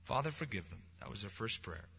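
very soft, hushed voice; hushed reverence. Real, not acted. Preacher to congregation, hushed and reverent. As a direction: drop to near silence, reverent.

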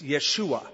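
"Yeshua" as yeh-SHOO-ah; Hebrew pronunciation. Shown as a demonstration. Preacher linking it to the name of Jesus, Hebrew pronunciation of "Yeshua". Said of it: "The Hebrew pronunciation is Yeshua".

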